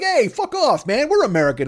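gruff mock American tough guy; America talking. America telling off New America, mock tough. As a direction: play America brushing them off. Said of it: "America's like".

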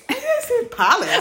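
high, loud giggling question; laughing high. Real, not acted. Friend laughing at her slip, loud high giggle. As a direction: laugh it off in disbelief.

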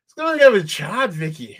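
high mock British accent, fading out on the name; second go at the British detective line; mock British cop. Quoting the show again, mock British accent.

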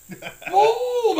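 high, loud drawn-out blast; shouted sound effect; explosive boom. Retold or imitated. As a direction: burst it out like an explosion. Imitating an explosion in the story.

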